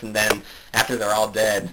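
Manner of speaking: normal talking voice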